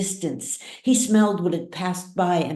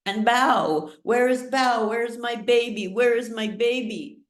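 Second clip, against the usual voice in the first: frantic mother; 6 semitones higher than usual. A narrator's reading. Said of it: "Junmai cried".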